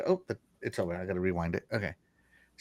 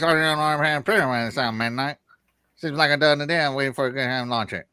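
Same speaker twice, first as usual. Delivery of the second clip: put-on Southern accent. Host reads gamer's chat comment, joking Southern accent. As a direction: read it in a hammed-up Southern accent. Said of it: "couldn't help myself but to use that accent".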